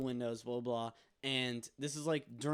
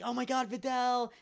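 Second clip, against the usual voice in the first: pitched way up and louder, a mock excited gush; mocking Vidal; 10 semitones higher, 5 dB louder than usual. Party guests fawning over Vidal, pitched up and a bit louder. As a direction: ham up a star-struck fan. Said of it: "He's like".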